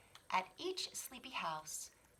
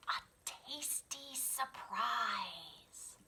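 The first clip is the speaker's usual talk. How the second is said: slow whisper; 4 semitones higher, much slower than usual. Acted. Reading sign softly.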